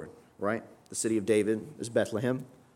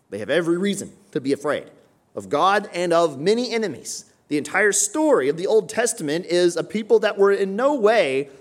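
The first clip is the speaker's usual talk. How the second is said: loud, high preaching voice; fiery preaching; 8 semitones higher, 7 dB louder than usual. Acted. Pastor stirs the congregation, loud high preaching.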